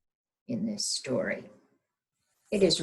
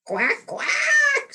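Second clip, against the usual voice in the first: very high, loud quacking; squawking duck; 8 dB louder than usual. Acted. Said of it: "squawked Duck".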